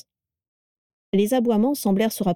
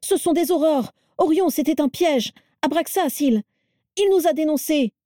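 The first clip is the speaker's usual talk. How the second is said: high, frantic accusation; panicked exclamation; 9 semitones higher than usual. A narrator's reading. Crabbe warning Orion of a trap, panicked and high. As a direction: cry betrayal in rising panic. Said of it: "s'exclama-t-il affolé".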